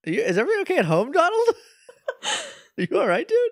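high-pitched, quick, amused worry; laughing mock concern. Listener asking Donald about home life, laughing mock concern.

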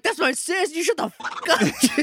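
high, indignant sibling outburst; mock angry retort. Vita telling Chip off over her sister, mock angry. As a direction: fire back in a high, heated voice. Acted sibling quarrel in recap.

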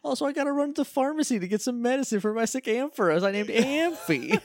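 put-on very high girlish voice, laughing; timid girl voice. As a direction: squeak it like a giggly shy girl. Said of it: "She's like".

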